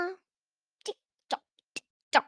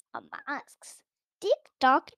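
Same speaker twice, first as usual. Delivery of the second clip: usual speaking voice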